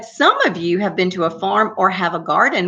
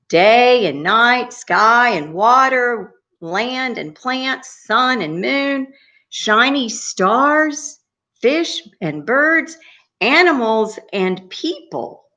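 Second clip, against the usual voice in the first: slow, higher, measured recital; storytelling list to children; 6 semitones higher, slower than usual. A narrator's reading. Storyteller lists all God made for children, slow lifted recital. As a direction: stretch out each pair slowly.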